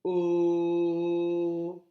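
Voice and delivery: "O" held long, loud and steady; letter sound demo. Shown as a demonstration. Teacher showing the sound of the letter, long held "O". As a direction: sustain "O" as one even vowel. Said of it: "qui fait le son".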